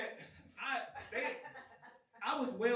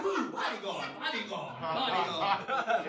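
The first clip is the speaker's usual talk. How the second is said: lower-voiced awed locals, drawing out the last word; impressed Japanese fans; 4 semitones lower, 7 dB louder, slower than usual. Japanese locals hear he is a bodyguard, mock awe. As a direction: imitate impressed locals repeating a new word. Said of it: "They were like".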